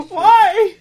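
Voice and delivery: very high, very loud "why"; laughing wail. Real, not acted. Co-host tormented by the candy trailer, laughing wail. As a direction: wail it in comic despair, laughing. Mock despair at teasing trailer.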